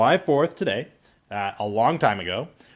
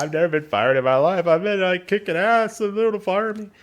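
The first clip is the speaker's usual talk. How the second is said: mock crying; 7 semitones higher, 3 dB louder than usual. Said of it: "fucking crying my eyes out".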